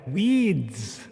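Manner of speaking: "Weeds" stretched out, falling at the end; clarifying a word. Acted. Host repeating slang for baffled co-host, drawn-out clarifying. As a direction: repeat "Weeds" as one long, insistent word. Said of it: "having trouble understanding my Australian accent".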